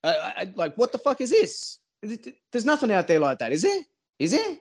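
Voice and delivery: high, incredulous rising questions; disbelief. Retold or imitated. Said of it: "just going".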